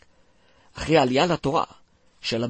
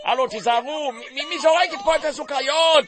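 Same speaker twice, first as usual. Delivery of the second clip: alarmed shout; 11 semitones higher than usual. Acted. Someone alarmed at flying candy.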